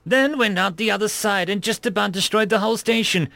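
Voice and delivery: high-pitched, appalled outburst; horrified. A narrator's reading. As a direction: demand an explanation, aghast. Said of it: "Ragnar was horrified".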